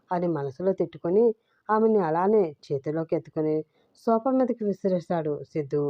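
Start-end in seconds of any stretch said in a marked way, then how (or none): none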